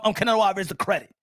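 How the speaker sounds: ordinary talk, nothing marked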